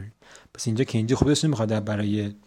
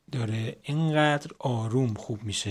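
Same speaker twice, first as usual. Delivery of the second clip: ordinary delivery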